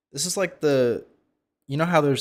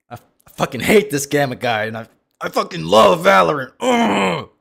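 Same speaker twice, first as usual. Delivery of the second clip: hammed-up furious yelling, higher and much louder; mock rant; 7 semitones higher, 13 dB louder than usual. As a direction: scream it like a raging Valorant addict. I don't love Valorant, guys.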